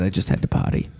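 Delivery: whispered quote. Retold or imitated. Quoting a guest's excuse.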